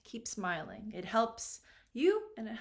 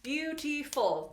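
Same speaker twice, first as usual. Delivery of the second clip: slow, higher, three stretched beats on "beautiful"; syllable by syllable; 3 semitones higher, much slower than usual. Shown as a demonstration. Teacher counting syllables for students, syllable by syllable. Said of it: "Three syllables".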